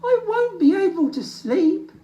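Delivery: high, loud sad little bear; sadly. Acted. Little Bear to Old Bear, sad and high. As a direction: moan it unhappily. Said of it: "said Little Bear sadly".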